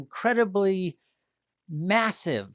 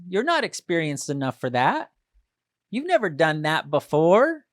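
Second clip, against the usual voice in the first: higher, louder mocking put-down; scolding doubt; 5 semitones higher, 6 dB louder than usual. Negative inner voice scolding Kale, mocking. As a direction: scoff at your own ambition. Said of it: "It's the voice that says".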